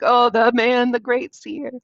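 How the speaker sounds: butter him up with exaggerated admiration; mock flattery. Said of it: "He kind of flatters him first".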